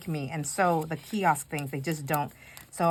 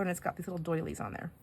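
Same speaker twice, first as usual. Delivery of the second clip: normal talking voice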